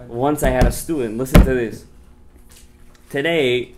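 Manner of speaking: ordinary talk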